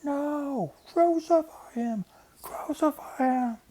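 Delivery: very high, slow, full-throat scream; screaming mob. A narrator's reading. Mob overpowers Pilate, very high slow screaming. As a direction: scream it at the top of your lungs. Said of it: "screamed out at the top of their lungs".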